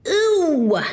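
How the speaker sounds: high, loud "Ooh!" of rage; frustrated angry squeal. A narrator's reading. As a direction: squeal out the fury. Fury after hang-up.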